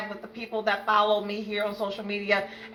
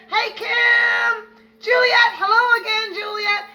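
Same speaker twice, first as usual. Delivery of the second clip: high, loud calling out names; excited greeting; 10 semitones higher, 4 dB louder, slower than usual. Real, not acted. Host calling out viewers' names, excited and loud. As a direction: call each name out with joy.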